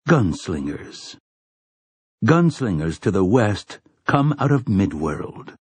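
plain reading voice

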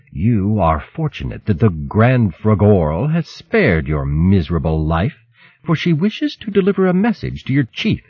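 lightly voiced sneering rat; cold, scornful rat guard; contemptuous rat voice. Rat guard speaks again.

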